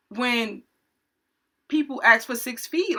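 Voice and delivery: usual speaking voice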